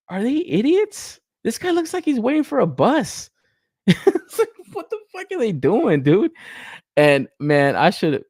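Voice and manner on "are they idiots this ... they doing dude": high, exasperated disbelief; incredulous mockery. Real, not acted. Reviewer mocks the makers over a boring pose, incredulous. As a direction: pitch soaring, breaking into a laugh.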